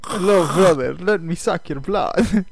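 mock vampire accent, slowing down; Dracula. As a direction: ham it up as a vampire, drawing out the threat. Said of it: "I just sound like Dracula".

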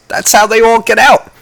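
gleeful eureka; excited. Real, not acted. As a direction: proclaim the theory. Excited zombie theory.

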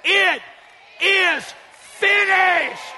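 loud, high, word-by-word proclamation; triumphant cry. Acted. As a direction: cry it out to make Satan tremble. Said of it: "He said it so Satan would tremble".

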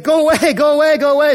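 high, loud, impatient go away repeated; annoyed judge shooing. Retold or imitated. Preacher voicing the irritated judge dismissing the widow, loud and high. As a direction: shoo her off like a fed-up judge. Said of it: "He's like".

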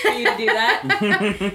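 laughing. Real, not acted. Laughing while asking.

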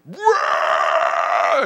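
loud alarmed wail at greasy hands; startled yelp. Retold or imitated. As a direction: yelp in mock horror. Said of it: "And I'm like".

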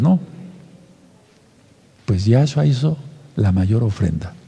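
ordinary delivery

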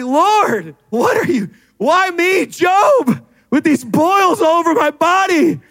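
high hammed-up wail, building; mock anguished complaint. Sufferer asking the Lord why me, mock anguished wail. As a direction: wail at heaven like a suffering Job. Acted complaint to God like Job.

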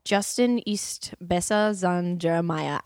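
slow, careful half-German; slowly trying out the German; slow German attempt. Shown as a demonstration. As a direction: feel your way through the German. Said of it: "Can you say that in German?".

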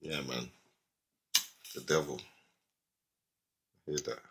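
dropped low and hushed, spooky; low eerie hush. Pretend. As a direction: hush it like a ghost story. Spooky hush about the devil.

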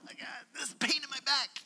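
imitating a friend in pain; pained groan. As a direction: act out a friend hit by sudden back pain. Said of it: "about ten seconds later".

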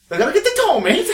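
put-on high, shouted, muddled cockney accent; badly faked cockney, breaking into laughter; invented cockney. Repeating a flustered invented dialect, hammed-up fake cockney. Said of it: "don't even know what that accent was".

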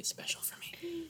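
hushed, breathy whisper. Real, not acted. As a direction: drop to a secret whisper. Sudden whispered aside.

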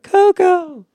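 very high squealed name. Retold or imitated. Friend reacting to photos, high squealed name. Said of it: "And I'm like".

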